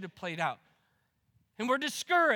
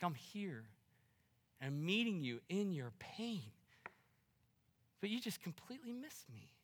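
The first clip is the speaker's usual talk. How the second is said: much softer, tender plea; gentle Jesus voice; 5 semitones lower, 14 dB quieter than usual. Pretend. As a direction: speak as Jesus, quietly pleading. Voicing Jesus softly to listeners.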